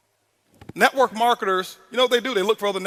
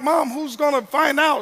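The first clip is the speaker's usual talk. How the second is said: higher, drawn-out mock complaint; slow, higher-pitched exasperated question; puzzled son to mom; 5 semitones higher than usual. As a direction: imitate a boy questioning his mother. Acts himself as boy questioning mom.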